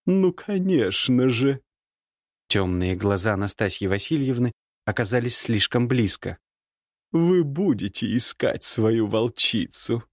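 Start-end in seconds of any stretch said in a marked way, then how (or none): 0.1-1.6 slow mocking lady; teasing woman. Nastasya voiced. 7.1-10.0 slow teasing lady's voice; teasing woman. Acted. Nastasya voiced.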